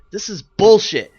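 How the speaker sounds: loud, furious blurt; angry outburst. Real, not acted. Caster fuming at a player who abandoned his partner, loud and angry. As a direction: vent real rage at the teammate. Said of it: "Listen to the rage".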